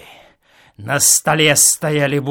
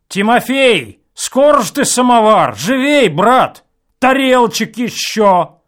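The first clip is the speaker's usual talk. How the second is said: loud, higher call for the servant; 8 semitones higher, 9 dB louder than usual. A narrator's reading. Reveller shouts across the room for the servant, loud. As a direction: hurry the servant up, loudly.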